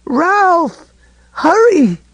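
high, frantic shout in terror; terrified cry. A narrator's reading. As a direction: cry out for help, panicked. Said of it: "and now she was in terror".

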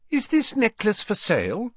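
put-on very high, girlish-customer question; chirpy Hermione. A narrator's reading. Hermione browsing in Borgin's shop, chirpy.

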